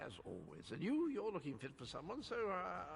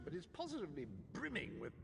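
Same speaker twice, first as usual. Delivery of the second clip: ordinary talk, nothing marked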